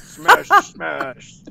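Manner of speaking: slow, hammed-up ogre critic. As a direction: rumble through it word by word like a cartoon ogre. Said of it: "present all product critique as ogre".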